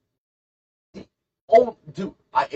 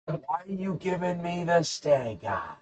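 high, slow, drawn-out silly voice; exaggerated mock complaint; hammed-up question; 8 semitones higher, 6 dB quieter than usual. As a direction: stretch STANK EYE out in a high mock whine. Second, hammed-up take of question.

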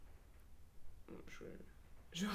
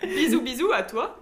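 laughing; 2 semitones higher, 9 dB louder than usual. Real, not acted. Giggling over autocorrect text.

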